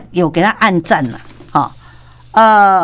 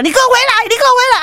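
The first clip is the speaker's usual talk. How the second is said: shouting after him; 13 semitones higher, much faster than usual. Retold or imitated. Manager to the fleeing man, shouting come back. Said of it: "先内鬼".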